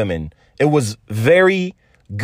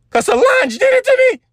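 squealed confession; 3 dB louder than usual. Real, not acted. Overwhelmed confession in car.

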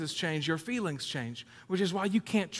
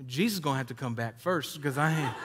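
laughing joke; 2 semitones lower than usual. Retold or imitated. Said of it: "And I was like".

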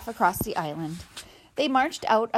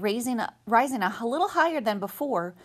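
ordinary delivery

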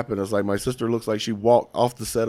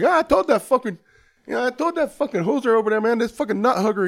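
much higher hammed-up Canadian cussing; thick Canadian accent; 14 semitones higher, 3 dB louder than usual. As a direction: cuss someone out in an overdone Canadian accent. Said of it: "a thick fucking Canadian accent".

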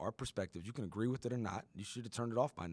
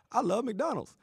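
much higher, louder mock gush over fast food; mock casual gush; 7 dB louder than usual. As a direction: gush like a fan over a burger. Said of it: "we'll just be like".

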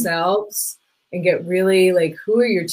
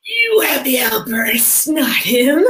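slow, higher, pointed retort; pointed comeback; 5 semitones higher, slower than usual. Retold or imitated. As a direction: set her straight, deliberately. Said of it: "I was like".

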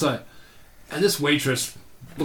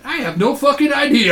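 much higher and louder, a blurted yell; incredulous shout; 12 semitones higher, 7 dB louder than usual. Retold or imitated. Answering his friend about the blonde, loud incredulous shout.

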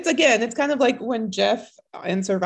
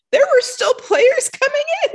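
much higher and louder, incredulous; stunned disbelief; 14 semitones higher, 7 dB louder than usual. Retold or imitated. Jackie learning more players were coming, high and loud. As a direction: squeak it like the news is a total shock. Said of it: "she's like".

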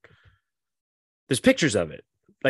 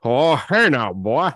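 louder hammed-up old man; 3 semitones higher, 7 dB louder, slower than usual. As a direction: drawl it like a grumpy old man. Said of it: "That's his attitude and sounds".

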